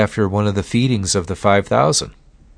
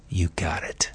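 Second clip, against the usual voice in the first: menacing whisper; Satan's whisper; 3 semitones lower, 11 dB quieter than usual. Retold or imitated. Satan answering the Son of God in a menacing whisper. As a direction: hiss it low like a threat. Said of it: "can't you almost hear Satan saying".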